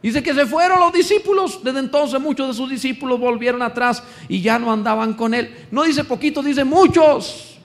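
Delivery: high and loud sermon push; fiery preaching. Real, not acted. Preacher pressing the congregation, high and loud. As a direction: preach it hard to the room.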